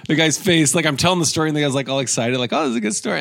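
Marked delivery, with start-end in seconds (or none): 2.5-3.2 high keen casting director; lightly voiced interested listener; mock eager. Casting director enjoying the story, high eager voice.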